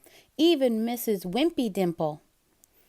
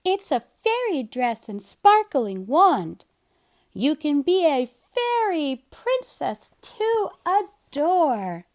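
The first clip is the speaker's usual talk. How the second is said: Kitten's voice; 6 semitones higher, 5 dB louder than usual. Acted. Kitten offering Seymour a fairy costume, gushing. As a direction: gush over the fairy dress.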